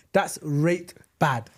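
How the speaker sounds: slow Yorkshire That's rate bad; Yorkshire accent. Shown as a demonstration. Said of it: "in the Yorkshire accent".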